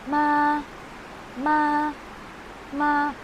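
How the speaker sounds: "Ma" held high and level, drawn out; flat first tone. Shown as a demonstration. Teacher demonstrating Mandarin first tone, flat high "Ma". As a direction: say each "Ma" on one steady high pitch. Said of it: "pronounce that is like this".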